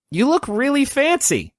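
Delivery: put-on light girlish compliment; young girl. Mavis compliments her dressed-up father, high girl voice. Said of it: "she says".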